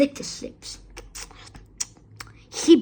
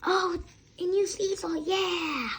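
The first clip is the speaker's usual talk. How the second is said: put-on 1 excited children; 6 semitones higher, 5 dB quieter than usual. A narrator's reading. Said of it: "shouting with excitement".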